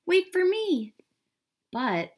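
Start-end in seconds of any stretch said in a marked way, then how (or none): 0.1-0.9 high, loud plea from a duckling; crying out. A narrator's reading. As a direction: call desperately after the departing family. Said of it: "cried the ugly duckling".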